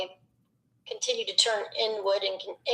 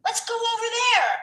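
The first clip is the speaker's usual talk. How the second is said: put-on high, slow call to the other kids; lightly voiced kid inviting friends to play; playful kid calling; 13 semitones higher, slower than usual. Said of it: "You're like the kid on the playground".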